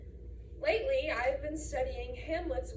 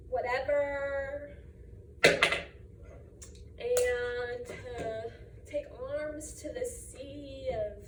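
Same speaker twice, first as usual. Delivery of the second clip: slow, drawn-out, halting delivery; listless teen-style recital with long pauses; sister's bored reading; 2 semitones lower, slower than usual. Acted. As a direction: stretch the words as if you can't be bothered. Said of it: "My sister Lola thinks I should say".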